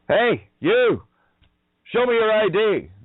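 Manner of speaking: high, loud barked demand for ID; commanding shout. Acted. Man confronting Masonic goons, loud high challenging shout. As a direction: bark it like you're stopping someone at a checkpoint.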